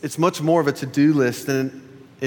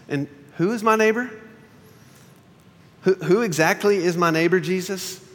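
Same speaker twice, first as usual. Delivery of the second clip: higher, pointed questioning; self-justifying question; 6 semitones higher than usual. Retold or imitated. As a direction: ask it like a man justifying himself. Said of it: "he asked Jesus".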